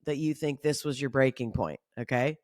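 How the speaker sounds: a condescending little eye-roll of a line; patronising sarcasm, quiet; sarcastic aside. Retold or imitated. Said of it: "I watched that and I was like".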